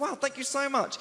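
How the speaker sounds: high-pitched gushing gratitude; retold delighted thanks; flattered thanks. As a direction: gush it high, taken aback. Flattered comic thanking his admirers.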